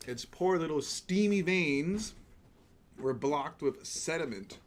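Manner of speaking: mock tragic. Mock tragic steamer eulogy.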